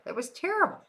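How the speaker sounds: "terrible" jumps way up high at the end; incredulous high-pitched echo of "that was terrible"; high rising repeat. Shown as a demonstration.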